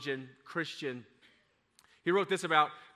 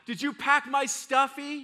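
put-on child asking; 10 semitones higher, 6 dB louder than usual. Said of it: "one of the kids asked".

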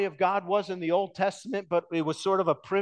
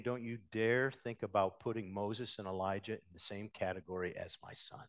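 hushed stern rebuke; 6 semitones lower, 8 dB quieter than usual. Acted. God rebukes Peter, voiced quietly.

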